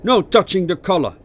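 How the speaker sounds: high, loud command; soldier's shout. A narrator's reading. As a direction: cry out a sharp prohibition. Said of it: "cried a soldier with an African accent".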